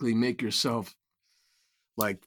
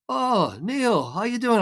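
put-on higher, lifted voice of an old father waking up; imitating elderly father; 10 semitones higher, slower than usual. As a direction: play the frail old dad, pitched up. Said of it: "He goes".

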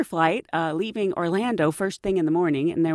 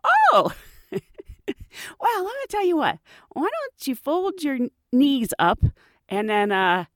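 amused advice; 6 semitones higher than usual. Retold or imitated. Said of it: "flight attendant goes".

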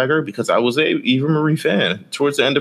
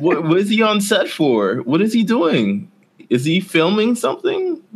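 higher, laughing incredulous questions; laughing disbelief; 10 semitones higher than usual. Real, not acted. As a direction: ask it while cracking up in disbelief. Mocking the "on set" claim.

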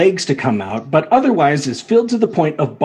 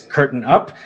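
ordinary delivery

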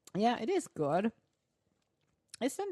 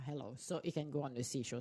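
ordinary talk

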